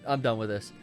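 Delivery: ordinary talk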